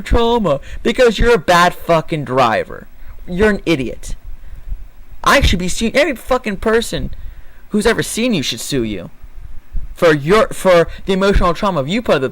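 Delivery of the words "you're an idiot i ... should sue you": normal talking voice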